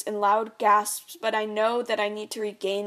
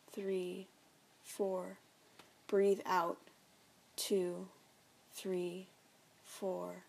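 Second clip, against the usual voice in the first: calm counting; 4 dB quieter, much slower than usual. A narrator's reading.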